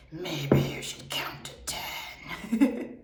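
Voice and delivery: slow, whispery gremlin voice. Retold or imitated. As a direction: creep it out like a gremlin. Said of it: "The gremlin would be like".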